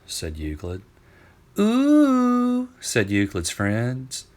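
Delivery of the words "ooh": very high loud mocking ooh; high loud sing-song ooh; teasing ooh. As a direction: tease like schoolkids. Friends tease about girlfriend.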